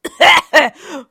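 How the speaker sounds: loud choking cough; spluttering cough, high and strained; hard coughing fit. Acted. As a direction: hack it up loudly, choking. Harry coughing up foam.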